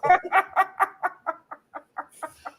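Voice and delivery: high-pitched, long fit of laughter. Real, not acted. Storyteller losing it over the dog story, long laughter.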